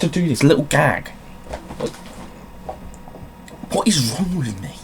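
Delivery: real giddy exasperation; exasperated outburst. Real, not acted. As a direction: blurt it out, cracking up at yourself. Laughing exasperated outburst at himself.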